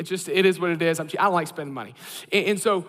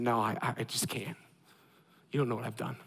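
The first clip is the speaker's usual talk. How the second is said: hushed, lower and much softer; lower, hushed voice of a guilty heart; guilty murmur; 10 semitones lower, 10 dB quieter than usual. Retold or imitated. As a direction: voice the shame as a quiet confession. Voicing guilty inner thoughts.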